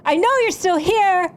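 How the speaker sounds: high, strained shout into the night; defiant shout. Acted. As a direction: call out so he can't stay hidden. Calling out to hidden magician.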